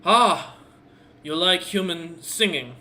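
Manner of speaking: brightened orc, loud opening then eager question; brightening up. Acted. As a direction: perk up with a big, pleased burst. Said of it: "seems to brighten up and goes".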